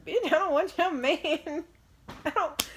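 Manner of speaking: high, loud, slow sassy clapback; sassy comeback. Retold or imitated. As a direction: put her firmly in her place. Snapping back at jealous lady.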